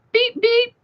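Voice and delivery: high, bright tooting beep; mock scooter horn. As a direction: toot it like a little scooter horn. Imitating her friend's scooter horn.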